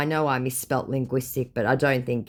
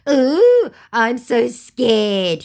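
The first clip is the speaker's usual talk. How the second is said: high, loud, drawn-out "ooh"; hammed-up fake fright, very slow; mock scared; 10 semitones higher, 9 dB louder, much slower than usual. Scammer scoffs at threat of arrest, mock scared.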